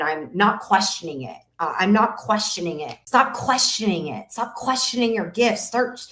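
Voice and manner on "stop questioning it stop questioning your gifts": ordinary talk, nothing marked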